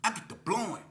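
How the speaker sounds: normal talking voice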